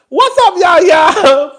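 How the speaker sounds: loud, high shout-out to the crowd; calling out. Real, not acted. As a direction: holler a greeting across the city. Shouting a greeting to New Yorkers.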